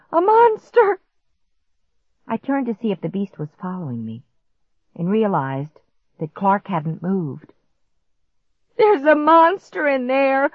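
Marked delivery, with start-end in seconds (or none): 0.1-1.0 very high, loud cry of alarm. A narrator's reading. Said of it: "I cried out". 8.8-10.5 high, loud wail of alarm; panicked wail. A narrator's reading. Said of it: "I wailed".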